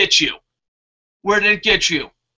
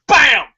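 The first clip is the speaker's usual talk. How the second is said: shouted cartoon "Bam!", higher and louder; mock punch sound; 7 semitones higher, 7 dB louder than usual. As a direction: slam out the comic punch noise. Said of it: "Be like".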